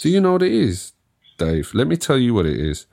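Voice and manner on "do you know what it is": high-pitched mock echo; teasing, squeaky repeat. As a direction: parrot the question back in a high voice. Parrots the other speaker's question.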